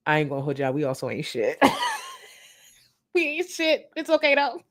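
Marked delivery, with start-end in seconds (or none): 1.6-4.6 high, laughing yell of self-mockery; laughing shout. Real, not acted. Laughing at herself while telling chat they ain't shit. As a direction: crack up and holler it high.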